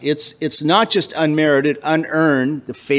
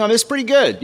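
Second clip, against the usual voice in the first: higher-pitched pleased self-talk; mock a writer pleased with the draft; self-satisfied; 7 semitones higher, faster than usual. Student admiring a first draft, self-satisfied.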